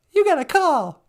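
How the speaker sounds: high, loud falsetto call; put-on high voice. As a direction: sing out sweetly in falsetto.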